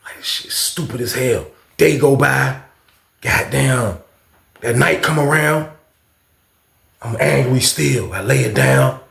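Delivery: usual speaking voice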